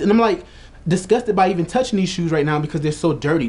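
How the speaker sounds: normal talking voice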